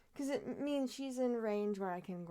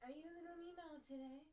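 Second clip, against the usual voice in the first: higher, much softer coo to the kitten; 7 semitones higher, 12 dB quieter, faster than usual. Real, not acted. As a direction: coo gently at the cat. Baby talk to cat.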